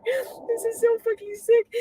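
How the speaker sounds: slow, high, crying wail; real sob breaking through; weeping in despair; sobbing. Real, not acted. Crying in distress.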